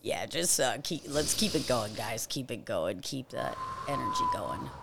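very low, very soft guidance; hushed breathing coach. Real, not acted. Host keeping everyone breathing through the minute, hushed and low. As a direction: murmur low encouragement to the group.

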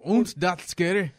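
slow German attempt sliding into Scottish; failed German accent. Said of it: "that was more Scottish than anything".

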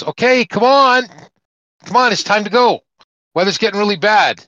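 high loud urgent call over the wind. Acted. Helicopter rescuer shouting to man over hurricane, loud urgent call. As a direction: yell him into the helicopter.

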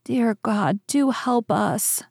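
pleading cry. A narrator's reading. Weeping Gretel praying for help, gently pleading. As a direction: plead helplessly, understated. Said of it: "she cried".

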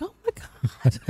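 high, soft, half laughing through tears; overwhelmed and choking up; teary oh my god. Retold or imitated. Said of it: "I started bawling immediately".

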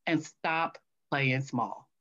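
measured, word-by-word retelling; slow, weighty advice. Retold or imitated. Attendee's key advice retold.

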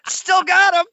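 very high, loud cheer; gleeful boast. Real, not acted. Host announces leftover freebies to co-host, high loud glee. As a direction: crow it triumphantly.

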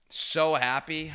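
real delight, slowed right down; gushing. Real, not acted. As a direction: stretch it out with pure joy. Gushing about Whistler.

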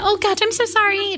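high, gushing mock apology; high-pitched "so sorry" act; mock apologetic. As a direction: imitate yourself over-apologizing in a high voice. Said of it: "I was doing that".

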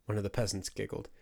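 plain reading voice

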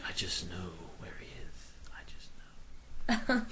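slow whispered mystic voice; breathy whisper. Retold or imitated. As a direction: breathe it out slowly. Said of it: "he's just like".

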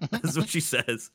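laughing in disbelief; cracking up mid-sentence. Real, not acted. Laughing at the archery story.

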